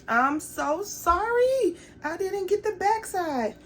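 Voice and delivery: high soft sorry whine; pleading apology. Real, not acted. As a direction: beg forgiveness in a small voice. Apologizing to viewers.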